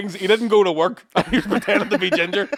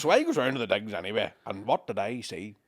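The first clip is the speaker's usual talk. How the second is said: put-on wrong Irish accent; 9 semitones lower, 7 dB quieter than usual. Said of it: "I know I'm doing the wrong accent".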